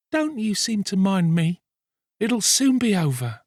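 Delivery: put-on high young woman's voice in distress; weary reassurance, pitched far above the narration; suffering girl. A narrator's reading. Nancy, faint, answers Sikes.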